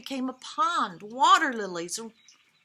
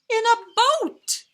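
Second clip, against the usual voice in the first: delighted reveal, much higher and louder; excited high exclaim; 11 semitones higher, 11 dB louder than usual. A narrator's reading. Reading to children, landing the funny surprise excitedly. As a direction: jump up high and loud on the boat.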